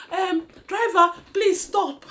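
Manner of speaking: loud, high yell of please stop; a passenger screaming at the driver to stop; retold scream. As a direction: shout to the driver from the back of the bus. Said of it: "I screamed from behind".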